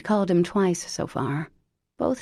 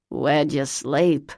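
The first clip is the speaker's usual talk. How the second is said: Lavinia's horrible voice; 3 semitones lower, slower than usual. Acted. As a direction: bark it out like a lifelong smoker.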